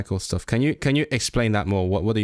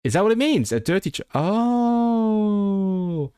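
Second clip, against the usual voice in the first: high-pitched question into a long oh; surprised realisation; 10 semitones higher, slower than usual. Real, not acted. Learner checking what the phrase means, surprised realisation. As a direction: react high, as the meaning finally lands.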